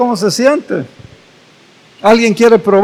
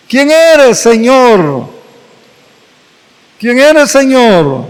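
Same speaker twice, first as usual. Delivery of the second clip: startled cry; 3 semitones higher, slower than usual. Acted. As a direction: cry out to the unseen voice in alarm. Saul cries out on the ground.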